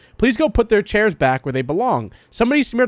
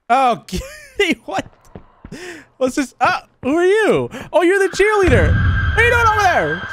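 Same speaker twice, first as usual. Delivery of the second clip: startled shriek; 12 semitones higher than usual. Real, not acted. Player spooked by the cheerleader ghost, high startled shriek. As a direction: jump out of your skin and squeal it higher and higher.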